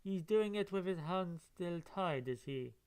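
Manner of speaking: ordinary delivery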